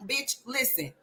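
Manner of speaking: ordinary talk, nothing marked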